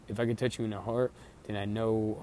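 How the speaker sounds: ordinary delivery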